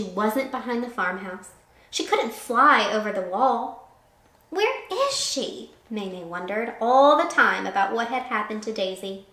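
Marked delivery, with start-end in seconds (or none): 4.5-5.7 high, slow, anxious wondering; a slow high fretful plea; worried question. Acted. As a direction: ask it with a pained worry. Worried owner asking.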